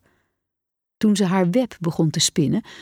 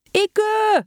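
very high, stretched shout; high-pitched eager pupils' yell; class yelling. A narrator's reading. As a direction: cry out like a whole class. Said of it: "brulde de hele klas".

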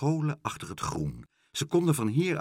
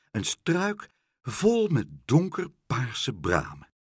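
ordinary delivery

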